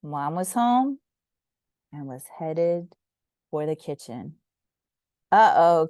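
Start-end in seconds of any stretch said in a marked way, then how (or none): 5.3-5.9 worried uh-oh. A narrator's reading. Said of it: "said together".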